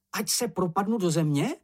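high voice, rising at the end; questioning Čenich. Acted. Čenich asks how big the secret is, high questioning voice. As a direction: ask the oath as a question.

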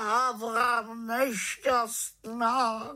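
high, drawn-out raven's wail; wailing in self-pity; moaning lament. Acted. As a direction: groan woefully, stretching the words. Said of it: "sténal dobrý Abraxas".

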